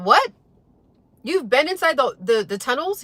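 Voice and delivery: high, loud disbelieving "What?"; surprised. Retold or imitated. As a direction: gasp out the question in shock. Shocked at friend's claim.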